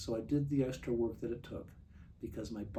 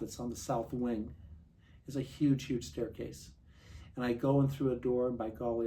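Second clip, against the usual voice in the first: ordinary talk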